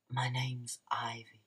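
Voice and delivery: whispered mock Ivy intro; mock breathy Ivy. Vlogger mocking Ivy's cringy intro, whispered. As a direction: hush it like a bored villain debut.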